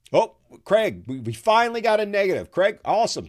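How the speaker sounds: real delight, pitched high and loud; excited. Real, not acted. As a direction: cheer the first negative answer. Host thrilled by a dissenting viewer answer.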